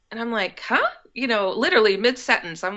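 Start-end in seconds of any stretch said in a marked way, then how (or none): 0.6-0.9 high-pitched baffled "huh?". Retold or imitated. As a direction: react as if caught off guard mid-talk. Baffled reaction to friend's sudden announcement.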